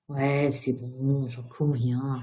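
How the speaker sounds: low, slow, grudging drawl; sighed admission. A narrator's reading. Ghost admitting his crime to Virginia, sighed admission. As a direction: concede it wearily, dragging the words. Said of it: "soupira Sir Simon".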